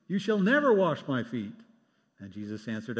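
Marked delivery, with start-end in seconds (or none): 0.1-1.6 higher, louder insistent refusal; adamant refusal. Retold or imitated. Peter insisting Jesus never wash his feet, adamant. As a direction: dig in your heels.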